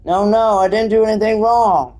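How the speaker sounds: continuous, theatrical denial. Acted. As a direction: plead innocence without pausing. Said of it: "Sorry, I read it so slowly".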